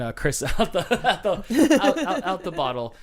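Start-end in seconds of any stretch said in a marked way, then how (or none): none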